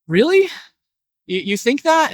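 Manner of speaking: sharp high rise on Really; surprised. Acted. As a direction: caught off guard by the question. Farmer surprised by the dog's question.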